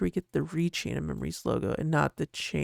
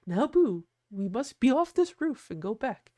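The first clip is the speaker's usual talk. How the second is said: lightly higher, gentle Sally voice; Sally impression; 5 semitones higher than usual. Sally gently telling Boo to come down off the roof. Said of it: "That's my Sally impression right there".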